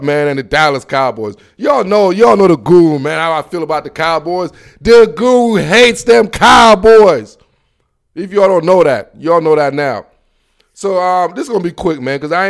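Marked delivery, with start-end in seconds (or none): 4.8-7.4 loud, slow, every word leaned on; emphatic declaration. Real, not acted. Host declares his dislike of the Cowboys, emphatic. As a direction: declare it loudly and drag it out.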